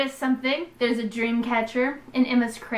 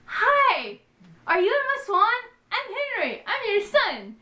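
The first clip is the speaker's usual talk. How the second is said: high, lightly voiced little boy; a hint of an eager kid; imitating kid Henry; 10 semitones higher than usual. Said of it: "and he's like".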